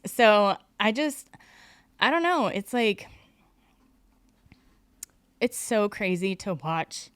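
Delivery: recovering from crying, still choked up; laughing through tears. Real, not acted. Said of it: "We like crying around here".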